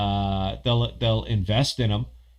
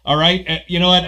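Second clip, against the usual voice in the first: higher, louder fake outrage at a viewer; mock rant; 7 semitones higher, 7 dB louder, faster than usual. Host rants at a viewer over ramen, mock angry and loud. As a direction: tear into him in joking fury. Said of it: "you know I'm busting balls".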